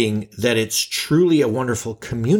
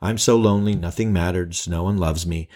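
mopey; 6 semitones lower than usual. Retold or imitated. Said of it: "I'm not thinking".

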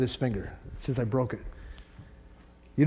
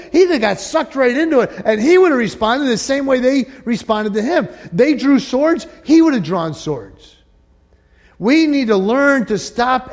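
rising, much louder and higher sermon voice; full-force preaching; 8 semitones higher, 6 dB louder than usual. Acted. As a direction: preach it hard to the congregation.

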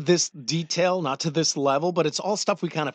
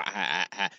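mock stammer; 9 semitones lower, 7 dB quieter than usual. Imitating stammering official.

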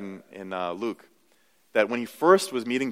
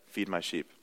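much softer, low "Feed my sheep"; soft Jesus command; 5 semitones lower, 11 dB quieter than usual. Acted. Pastor voicing Jesus's last words to Peter, hushed. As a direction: let the charge fall away gently.

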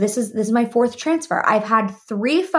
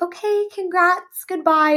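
chirpy coordinator; 10 semitones higher than usual. Retold or imitated. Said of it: "and she's like".

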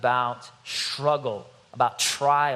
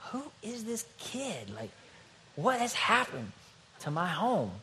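hushed, high-pitched disbelief; mock amazement; 7 semitones higher, 7 dB quieter than usual. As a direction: act out a stunned dad, barely above a whisper. Father acts out amazement at son.

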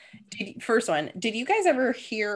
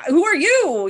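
much higher, louder comeback on "Who are you?"; retold indignant disbelief; 9 semitones higher, 8 dB louder, slower than usual. Sister answering a catfished stranger, indignant disbelief. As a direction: throw "Who are you?" back, high and loud. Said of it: "And she's just like".

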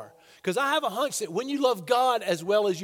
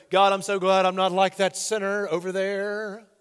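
smug Pharisee; 5 dB louder than usual. Retold or imitated. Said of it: "when he goes".

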